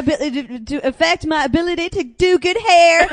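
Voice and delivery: put-on loud, high Southern accent; hammed-up Southern declaration, indignant and high; Steel Magnolias. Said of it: "where I get my southern accent".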